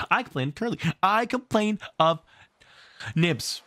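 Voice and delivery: high, whiny tattletale; tattling child. Acted. Children complaining to Wendy, high and whiny. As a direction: tell on everyone like a little child.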